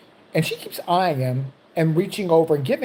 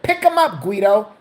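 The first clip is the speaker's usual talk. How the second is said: loud, higher exasperated shout; impatient order; 5 semitones higher, 5 dB louder than usual. Acted. Patient orders guard to lift the man, loud and impatient.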